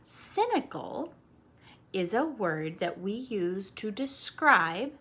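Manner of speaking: slow, careful word by word; teacher defining a word for kids; slow definition. Shown as a demonstration. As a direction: spell out the meaning. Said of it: "defining a vocabulary word".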